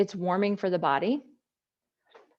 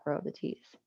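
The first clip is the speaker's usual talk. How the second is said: whispering; 4 semitones lower, 10 dB quieter than usual. Real, not acted. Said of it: "I said that the way I wanted to".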